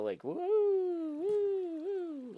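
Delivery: high, slow swooping woos; swooshing woo noises. Shown as a demonstration. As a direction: swing away from the mic going woo. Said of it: "I can just go".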